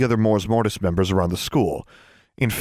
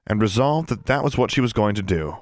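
ordinary delivery

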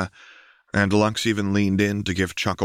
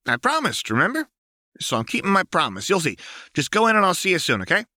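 higher, evasive and fidgety; nervous Jinx; 12 semitones higher, faster than usual. A narrator's reading. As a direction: dodge the question brightly.

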